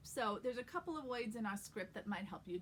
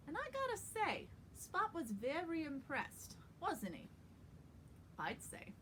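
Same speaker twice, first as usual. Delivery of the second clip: New York accent. Shown as a demonstration. Coach reads the whole scene for actors, New York accent. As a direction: read a script in an old New York accent. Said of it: "getting those words to sound very New York".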